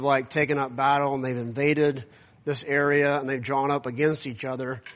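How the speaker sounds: ordinary talk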